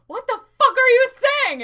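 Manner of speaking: loud, high, baffled yell; exasperated shout. Retold or imitated. French woman shouting at the brother, baffled yell. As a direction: yell in total confusion. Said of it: "until she was like".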